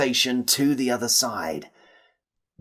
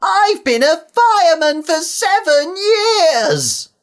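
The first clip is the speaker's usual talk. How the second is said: loud, high, drawn-out yell; a slow, piercing cry of panic; panicked call; 8 dB louder, slower than usual. A narrator's reading. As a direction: holler it in high-pitched alarm, stretching the words. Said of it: "called over to Herbert".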